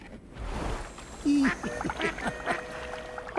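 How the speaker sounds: airy knowing laugh under the breath; quiet amused huff; breathy chuckle. Acted. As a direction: chuckle softly to yourself. Chuckles at failed attempts.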